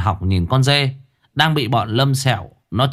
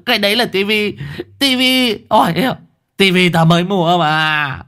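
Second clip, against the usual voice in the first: high, pleading howl; old Đồng howling at the goat to stop; wailing old man; 7 semitones higher than usual. A narrator's reading. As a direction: wail at the goat like a lone wolf. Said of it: "rú lên như chó sói cô đơn".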